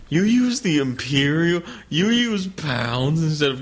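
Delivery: slow, pompous mock British accent; condescending British voice, drawn out. Mocking British speaker in argument.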